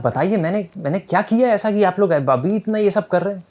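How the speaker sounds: high, heated, indignant outburst; a prisoner boiling over at a pointless visit; flaring up angrily. Acted. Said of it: "अब ये तो गुस्से से चढ़ जाता है".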